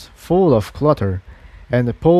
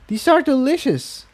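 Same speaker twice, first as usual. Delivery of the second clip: higher mare voice; 13 semitones higher than usual. A narrator's reading. Incendia voiced by narrator.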